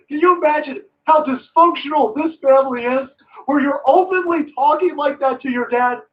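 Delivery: usual speaking voice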